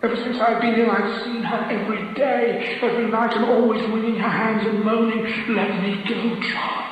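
high, loud feverish outpouring; fevered husband describing his dead wife's visits; crying out. A narrator's reading. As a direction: pour it out, gripped by the vision. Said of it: "he cried with such strength".

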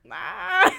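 short high frightened yelp; a sudden scared cry; startled gasp. Retold or imitated. Both reacting to the ghost voice.

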